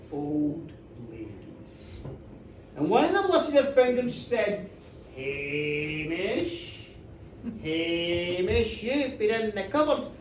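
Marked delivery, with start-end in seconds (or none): 5.1-10.1 low, soft, drawn-out name; quiet, ominous summons; accusing old lady. Acted. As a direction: intone it slowly like an eerie reproach. Old lady eerily accuses Hamish.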